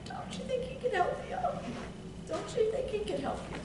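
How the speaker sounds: high but soft, pleading question; earnest preaching plea. Acted. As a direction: coax the listener gently.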